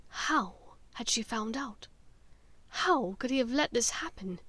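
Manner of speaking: ordinary delivery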